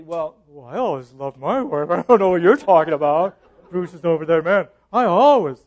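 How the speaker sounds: put-on voice of an indignant husband; hammed-up insistence, building to loud; mock protest. Husband denies love is only a choice, mock protest.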